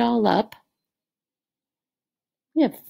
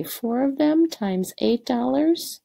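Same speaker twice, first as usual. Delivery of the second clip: ordinary delivery, nothing marked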